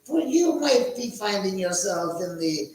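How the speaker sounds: high, loud cackling ghoul host; Crypt Keeper voice. Pretend. Cackling Crypt Keeper host greeting his viewers. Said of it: "I almost feel like the Crypt Keeper".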